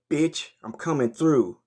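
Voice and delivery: ordinary talk, nothing marked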